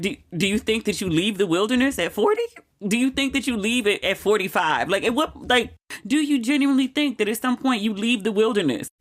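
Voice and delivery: incredulous. Retold or imitated. Incredulous reply to friend.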